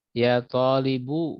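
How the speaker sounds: "Ya talibu" drawn out, with the final u of talibu sounded; slow demo. Shown as a demonstration. Teacher slowly models the call to a student. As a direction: stretch "talibu", ending cleanly on u. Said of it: "harus diingat kosakatanya".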